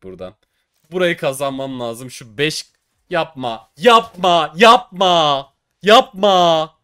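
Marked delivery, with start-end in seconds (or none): 3.8-4.5 yapma yelled higher and much louder; shouted plea. Real, not acted. 4.6-6.8 yelling yapma at the case, louder and higher each time; begging the case out loud; shouted pleading. Real, not acted. Case opening, begging the drop.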